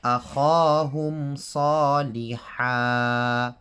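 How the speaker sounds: تلاوة القرآن; Quranic recitation. Shown as a demonstration. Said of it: "just read correctly like this".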